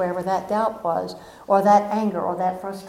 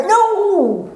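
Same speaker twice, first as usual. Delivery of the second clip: a high, loud, defiant "No!"; hammed-up protest, higher and louder; loud stubborn no; 10 semitones higher, 6 dB louder than usual. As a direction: shout the refusal back at the divine. Refusing again.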